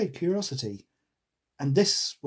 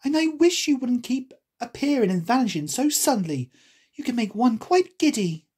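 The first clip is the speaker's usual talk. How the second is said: put-on higher-pitched, lively girl character; storybook heroine, pitched up; Alice; 9 semitones higher, 3 dB louder than usual. A narrator's reading. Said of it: "replied Alice".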